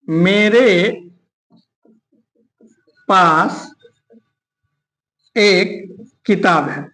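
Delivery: word by word with long pauses; low, drawn-out words with gaps between; slow dictation. Shown as a demonstration. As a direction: dictate it slowly as if writing it out. Writing out an example sentence.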